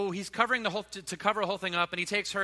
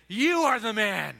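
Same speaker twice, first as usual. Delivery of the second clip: slow, louder, weighty pronouncement; solemn accusation; 3 semitones higher, 6 dB louder, slower than usual. Acted. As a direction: land it like a verdict, each word heavy. Nathan's climactic accusation.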